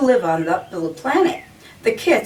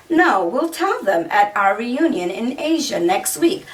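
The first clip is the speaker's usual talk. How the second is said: usual speaking voice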